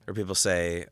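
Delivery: normal talking voice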